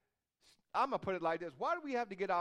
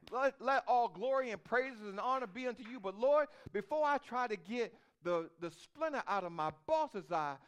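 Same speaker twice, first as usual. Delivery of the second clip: louder, raised preaching prayer; fervent prayer; 4 semitones higher, 5 dB louder than usual. Acted. Preacher praying aloud before the church, fervent and loud. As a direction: lift it up to God with force. Prays aloud at full force.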